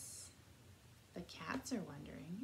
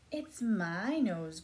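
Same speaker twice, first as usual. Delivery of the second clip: drawn-out, louder pig line, only lightly grunted; a slow, mild grunt for the pig; 7 dB louder, slower than usual. A narrator's reading. Pig claiming its nose in a picture book, mildly grunting. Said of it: "grunted the".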